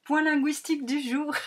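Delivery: playful radio-host announcement; segment announcement. Acted. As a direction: announce it like a jingle title. Announcing her recurring segment.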